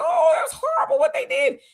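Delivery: hammed-up shocked cry; mock outrage. Mocking official outrage.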